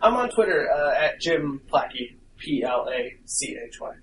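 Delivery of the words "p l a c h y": quiet letter by letter; spelling it out. Shown as a demonstration. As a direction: spell each letter, trailing softer. Spelling out Twitter handle.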